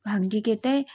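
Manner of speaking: ordinary delivery, nothing marked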